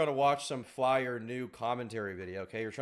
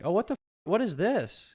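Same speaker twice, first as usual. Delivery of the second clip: shocked; 8 semitones higher, 3 dB louder than usual. Real, not acted. Shocked at costume.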